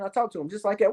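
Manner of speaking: ordinary talk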